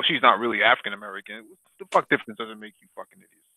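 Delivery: normal talking voice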